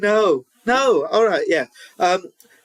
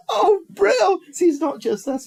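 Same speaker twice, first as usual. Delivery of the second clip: delighted laugh; 10 semitones higher than usual. Real, not acted. Delighted by viewer comment.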